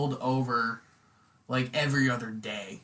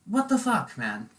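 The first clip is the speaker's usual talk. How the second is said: normal talking voice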